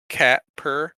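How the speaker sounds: normal talking voice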